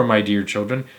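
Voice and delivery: plain reading voice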